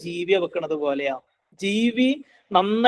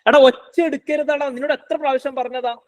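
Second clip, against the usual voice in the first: exasperated bad-example scolding, pitched up; mock scolding parent; 7 semitones higher than usual. Shown as a demonstration. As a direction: act out a fed-up parent telling a child off. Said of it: "അങ്ങനല്ല പറയണ്ടേ".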